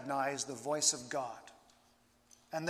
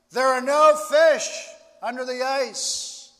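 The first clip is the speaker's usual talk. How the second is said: slow, loud and higher, like a voice from above; booming voice; 7 semitones higher, 10 dB louder than usual. Retold or imitated. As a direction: boom it down slowly from the sky. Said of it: "again he hears the booming voice".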